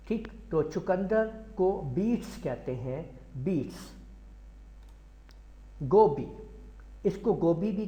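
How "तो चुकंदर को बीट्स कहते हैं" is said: normal talking voice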